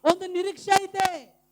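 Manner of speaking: loud, high proclamation building to a long cry; rousing pulpit climax; full-force preaching. Real, not acted. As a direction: preach to the rafters, ending on a held shout. Preaches the rising of believers.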